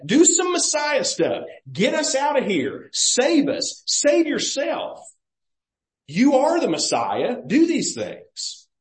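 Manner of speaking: high, insistent mock commands; mocking demand. Preacher voicing the defiant thief pressing Jesus, mocking demand. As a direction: imitate an accusing taunt, pitched well up. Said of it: "There's a mocking in the tone".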